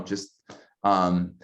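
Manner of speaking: normal talking voice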